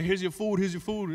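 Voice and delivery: ordinary talk, nothing marked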